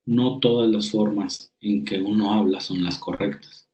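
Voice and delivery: usual speaking voice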